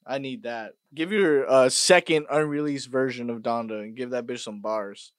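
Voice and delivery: ordinary talk, nothing marked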